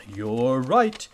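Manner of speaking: ordinary delivery, nothing marked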